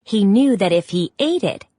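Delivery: usual speaking voice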